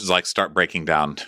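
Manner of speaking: usual speaking voice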